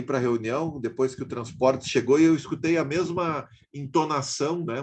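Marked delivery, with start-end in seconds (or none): none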